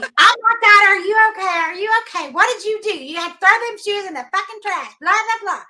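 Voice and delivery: high, loud mock panic; retold screaming. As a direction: imitate a frantic, scolding yell from behind. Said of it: "John's behind me screaming".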